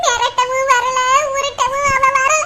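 wailing complaint between sobs; sobbing. Acted. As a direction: keep weeping while you explain. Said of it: "மூசு மூசுன்னு அழுகுறீங்க".

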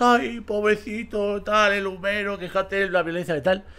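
sarcastic impression of a self-pitying victim; mocking whiny victim. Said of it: "no nos hagamos las victimitas".

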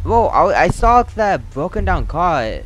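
high, loud alarmed outburst; rattled protest. Real, not acted. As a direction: protest loudly after a fright. Rattled just after the scare.

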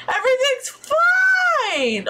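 high, slow, squeaky falsetto; squeaky everything's fine. Acted. Gamer insisting all is fine in a high squeak. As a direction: squeak it out in mock panic. Sim panicking, sarcastic reassurance.

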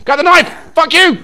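high, loud urgent squawk; frantic yell. Real, not acted. Player circling back for the knife, yelling. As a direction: shout the order in a panic. Chased while grabbing the knife.

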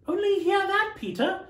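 a mother teasing her son. Acted. Mother voiced very high.

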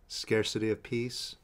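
soft, unhurried meditation guide with long pauses; guided meditation. Real, not acted. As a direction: guide them gently inward, leaving space between phrases. Leads a releasing meditation.

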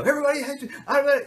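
put-on very high, loud kid outburst; mock wail. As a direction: explode in self-pity. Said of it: "go immediately on the attack".

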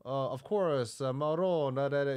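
imitating an actor putting on Italian; mock Italian. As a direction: toss off a sketchy Italian impression. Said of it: "Oh, could you do Italian?".

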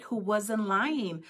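usual speaking voice

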